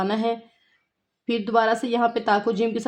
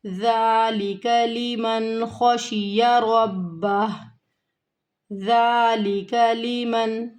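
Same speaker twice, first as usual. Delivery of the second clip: slow, drawn-out melodic tajweed recitation; Quran recitation; much slower than usual. Shown as a demonstration. Teacher recites the verse for her students, slow and melodic. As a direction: chant each word long and held.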